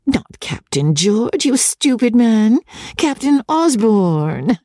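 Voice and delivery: high, slow scolding; high-pitched impatient lady's reproof; exasperated Rebecca. Acted. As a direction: correct him in a high, drawn-out chiding voice.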